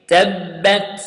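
تبت with the ta crisply stopped; clear ta sound. Shown as a demonstration. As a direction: bounce the ta clearly.

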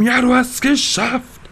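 mock cheering crowd. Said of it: "wie hier Leute reinlaufen".

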